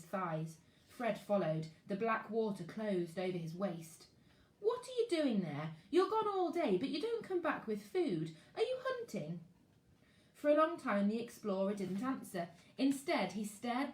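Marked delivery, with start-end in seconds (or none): 4.6-9.4 higher, probing questions; curious boy. Acted. Fred asking the explorer where he goes all day, higher curious. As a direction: press him for the truth.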